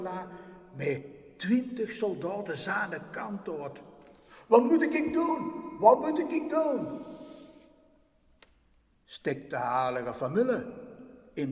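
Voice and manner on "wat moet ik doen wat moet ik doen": loud, high desperate cry upward; calling for help. Acted. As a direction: call up to heaven in panic. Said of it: "angel panics again".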